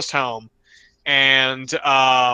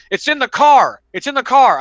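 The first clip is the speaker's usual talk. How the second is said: high, loud repeated plea; the frantic lady pointing to her car; panicked lady; 10 semitones higher, 7 dB louder than usual. Retold or imitated. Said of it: "She goes".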